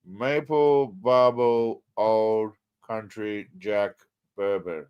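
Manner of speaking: very slow, low, absent-minded reading; reading while typing. Real, not acted. Host googling whether the drink is real, slow distracted reading. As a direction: draw out each word as you type it.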